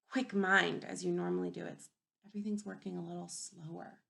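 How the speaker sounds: ordinary delivery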